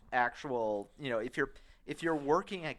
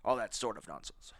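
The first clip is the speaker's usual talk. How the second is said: put-on old rich guy voice; 3 semitones lower, 5 dB quieter than usual. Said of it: "that's old rich guy sound".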